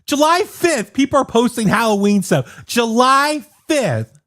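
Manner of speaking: high, incredulous complaint; exasperated. Real, not acted. Man fed up with early Halloween posts, exasperated. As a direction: drag out the date in disbelief.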